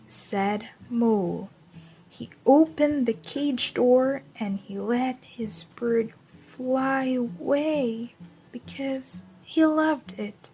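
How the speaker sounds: put-on gentle picture-book voice for young listeners; storybook reading. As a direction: read it like story time in class. Reads a picture book to children.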